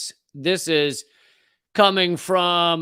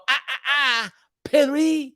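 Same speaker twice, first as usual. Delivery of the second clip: high mocking correction of Paris; ah-ah-ah, sneer it up high; snooty correcting; 10 semitones higher, 3 dB louder than usual. Mocking Americans who correct Paris pronunciation, high and snooty. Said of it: "very few people go".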